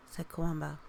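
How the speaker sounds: plain reading voice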